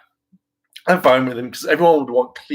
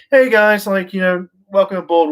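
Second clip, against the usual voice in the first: higher, louder welcoming pitch; friendly writers; 7 semitones higher, 4 dB louder than usual. Pretend. Writers greeting the new rangers with high, loud cheer. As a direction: welcome them aboard like an eager host. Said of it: "went to the writers and went".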